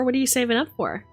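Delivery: usual speaking voice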